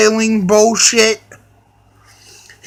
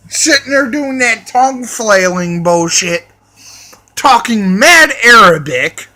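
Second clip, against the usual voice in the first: ordinary talk, nothing marked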